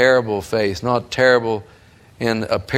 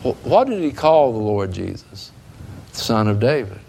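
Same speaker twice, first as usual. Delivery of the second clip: ordinary talk, nothing marked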